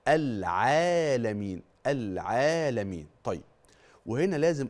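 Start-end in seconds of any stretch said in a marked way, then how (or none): 0.1-3.1 العالمين with the alif held two counts; slow drawn-out العالمين; elongated madd. Shown as a demonstration. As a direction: stretch the alif of العالمين evenly for two beats. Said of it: "ركز في حرف العين".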